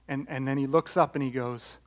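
normal talking voice

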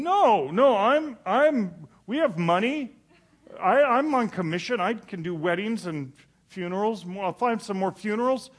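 high, loud, frantic protest; panicked protest. Retold or imitated. Preacher to saleswoman, insisting he can pay, in loud panic. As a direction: blurt it out in a rising panic.

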